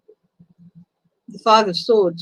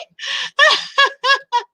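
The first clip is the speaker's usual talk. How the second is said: a teary, overflowing laugh; high emotional laugh. Real, not acted. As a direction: laugh high through the tears. Laughs after emotional outburst.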